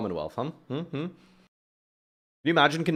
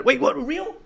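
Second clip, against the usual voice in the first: much higher startled question; surprised; 14 semitones higher, 4 dB louder, slower than usual. Real, not acted. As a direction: jolt up in disbelief. Shock at chat message.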